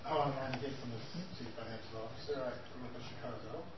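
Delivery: hoarse, losing voice; hoarse cry. Real, not acted. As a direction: voice nearly gone, strained and hoarse. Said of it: "Sorry, my voice is gone tonight".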